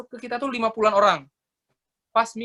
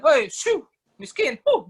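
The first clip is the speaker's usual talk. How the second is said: mock scornful shooing, higher and louder; rude rebuke; 7 semitones higher, 4 dB louder, slower than usual. Imitating someone driving off a beggar with a scornful shout. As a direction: shoo the beggar away with contempt.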